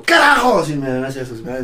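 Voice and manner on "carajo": high, loud startling yell; retold sudden shout. Superior startling a dozing recruit, loud high-pitched shout. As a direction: yell it abruptly into someone's ear. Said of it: "me grita, pues, en mi oreja".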